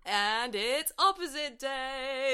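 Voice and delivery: loud, high, drawn-out singsong; mock announcement. Co-host exposing a friend's teasing, mock announcement. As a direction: stretch it into a gleeful mock proclamation.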